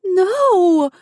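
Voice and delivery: high, loud desperate scream of no. Acted. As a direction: shriek it in horror. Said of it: "Gianna screamed".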